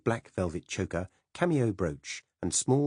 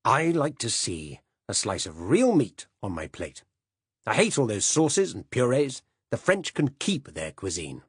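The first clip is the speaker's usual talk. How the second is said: put-on gruff old uncle; 5 semitones higher, 5 dB louder than usual. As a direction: lay down the law like a stubborn old man. Silas ranting about food.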